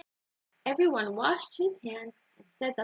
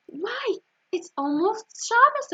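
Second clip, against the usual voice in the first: high, louder burst of sudden realization; startled realization; 10 semitones higher, 4 dB louder, slower than usual. A narrator's reading. David blurts it to Mother, suddenly realizing, high and loud. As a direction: it just hit him, blurt it high.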